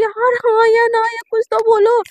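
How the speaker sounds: high, loud pushing for an answer; impatient demand. Retold or imitated. Girl demanding her crush answer, impatient. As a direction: press her for a yes or no.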